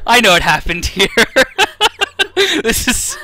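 words broken by high giggles; can barely talk for laughing; laughing hard. Real, not acted. Breaking up laughing at bug.

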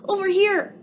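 a small alien calling Gork over. Acted. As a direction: shout across space to a friend. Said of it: "cried Bork".

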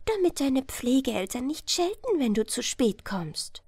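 put-on high, light fairy-child voice; high nixie children voice. Water-sprite children telling Ella to row home, high and light. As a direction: sing it out like playful water sprites. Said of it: "und sprachen zu ihr".

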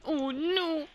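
sudden high yelp of surprise; surprised yelp. Real, not acted. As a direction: squeak out oh no in shock. Sudden game shock.

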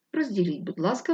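ordinary delivery

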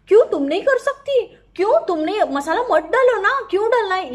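high, loud, whiny mimicry of a housemate; mocking whiny Siddharth. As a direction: squeal it back at him, mocking. Mocking Siddharth's kitchen complaint. (ऊँची, तेज़ आवाज़ में चिढ़ाते हुए नकल)

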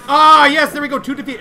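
high, loud burst of glee; loud high yes; excited cheer. Real, not acted. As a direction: celebrate the hit. Gets a kill.

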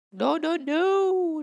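high, loud, drawn-out no; desperate shout. Real, not acted. Gamer losing the mission fish, loud desperate shout. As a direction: cry out as the prize slips away.

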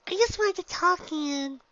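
very high, loud bubbly pony voice; Pinky, exclaiming. Acted. Pinky introduces herself to the gym leader, very high and bubbly.

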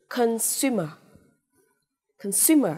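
"Consumer" as "con-SU-mer", middle syllable punched; careful teacher's "Consumer", stress in the middle; stress on second syllable. Shown as a demonstration. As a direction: model "Consumer" twice, leaning hard on "su". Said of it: "trọng âm tiết thứ hai".

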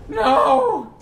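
high, loud cry of "no"; anguished gamer yell; dismayed scream. Real, not acted. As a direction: scream out in protest. Losing in the game.

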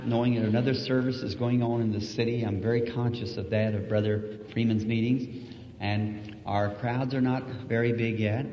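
ordinary talk

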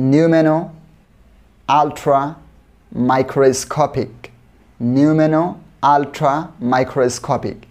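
drawn-out syllable by syllable, very slow. Shown as a demonstration. Teacher drilling a long word for students, very slow. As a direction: stretch every sound out for learners. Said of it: "তো আমি আস্তে আস্তে বলবো".

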